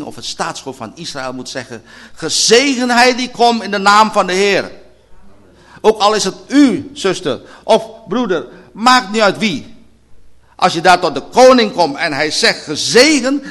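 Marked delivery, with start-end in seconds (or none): 2.1-4.7 loud proclamation. Acted. Preacher proclaims the king's welcome loudly. 5.8-9.8 fiery preaching. Acted. Preaching at full force. 10.6-13.4 fiery preaching. Acted. Preaching at full force.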